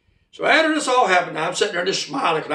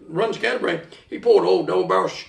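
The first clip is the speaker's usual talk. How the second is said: plain reading voice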